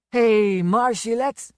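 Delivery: put-on a loud leering drunken shout; drunken call. A narrator's reading. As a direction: holler down the hall at her. Calling after her on stairs.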